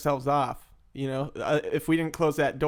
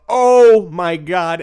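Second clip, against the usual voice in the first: loud exclaim; 3 semitones higher, 14 dB louder, much slower than usual. Retold or imitated. Reacting to snorting meth.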